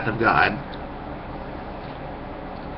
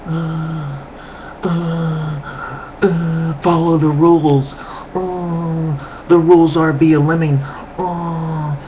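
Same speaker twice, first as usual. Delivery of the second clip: higher, dopey, halting drone; dim lemming; 5 semitones higher, slower than usual. Retold or imitated. Mocking Sims players as dull lemmings, higher and dopey. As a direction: play a brainwashed zombie following rules. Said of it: "people just sit there like".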